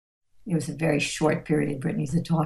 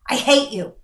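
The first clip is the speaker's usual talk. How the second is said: put-on high, louder childish outburst; mock angry kid; 7 semitones higher, 5 dB louder than usual. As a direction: throw it at a parent like a cross kid.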